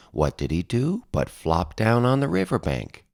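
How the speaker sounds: plain reading voice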